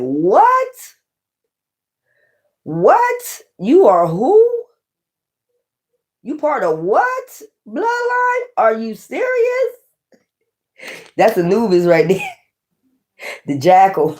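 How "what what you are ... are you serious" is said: very high, slow, crying disbelief; incredulous rise card. Acted. The rise card reacting to the bloodline claim in high incredulous cries. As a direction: squeal it out as the outraged rising card. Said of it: "the rise is like".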